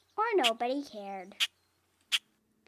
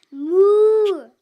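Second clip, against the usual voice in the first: loud, higher lowing moo; cow moo; 6 semitones higher, 13 dB louder than usual. A narrator's reading. Cow greets the little truck, loud moo. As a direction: call out a big moo like a cow. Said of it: "Cow said".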